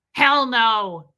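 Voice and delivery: loud mock-gruff refusal; imitated growl. Man brushing off his partner's teasing, mock growl. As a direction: hit the comeback loudly, as an imitated growl. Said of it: "He'd growl at me".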